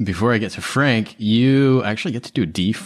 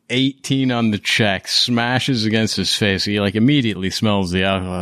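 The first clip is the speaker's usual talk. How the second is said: ordinary delivery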